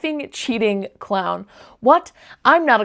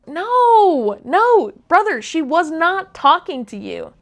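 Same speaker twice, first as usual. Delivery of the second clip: exasperated; 6 semitones higher, 5 dB louder than usual. Real, not acted. Exasperated reply to texter.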